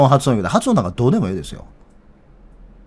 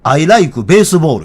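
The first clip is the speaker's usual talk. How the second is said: slow, louder Osaka-dialect English, pitch swinging up; Osaka-accented English; 4 semitones higher, 6 dB louder, much slower than usual. Shown as a demonstration. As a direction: correct them firmly in heavy Osaka-accented English. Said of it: "最初に習った英語が大阪弁やってんもん".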